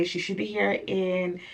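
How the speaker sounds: ordinary talk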